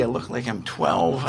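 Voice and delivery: normal talking voice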